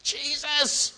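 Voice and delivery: high, strained call from far off; screaming out. Acted. Beggar up on his feet calls Jesus, mock scream. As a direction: imitate a scream at high pitch without full volume. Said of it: "He was screaming".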